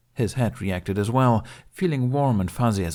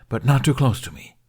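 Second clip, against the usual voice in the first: louder disgusted aside; 5 semitones higher, 6 dB louder than usual. Acted. Dragon adds demand, disgusted.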